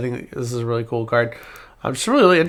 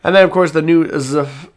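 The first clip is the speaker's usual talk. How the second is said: ordinary delivery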